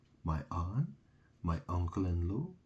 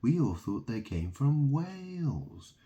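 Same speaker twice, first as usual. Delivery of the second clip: higher, exaggerated gasping wonder; mock news voice; 7 semitones higher than usual. Mocking news reports about Stonehenge, higher mock amazement. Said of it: "They're like".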